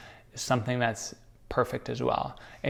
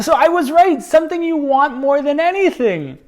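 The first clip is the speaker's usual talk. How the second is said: high, loud and gleeful; triumphant; 11 dB louder than usual. Real, not acted. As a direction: crow about being right. Triumphant about guessing right.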